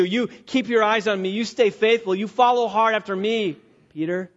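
loud, high, driving sermon voice; preaching at full force. Acted. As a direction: preach it out to the whole church, building. Preaching the Peter passage.

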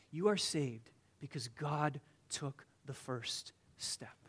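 slow whispered point to the congregation; hushed whisper. Acted. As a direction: lean in and whisper the truth. Sermon aside hushed.

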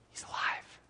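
breathless whisper of disbelief; whispered, awed. Acted. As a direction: hush it like a secret too good to be true. Hushed reveal of Daniel's survival.

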